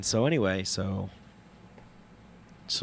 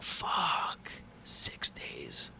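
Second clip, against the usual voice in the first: whispered and lower, deflated; despairing whisper; 10 semitones lower, 4 dB quieter, much slower than usual. Retold or imitated. Stuck six days in Iquitos, whispering to himself in despair. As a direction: breathe the words out like you've given up.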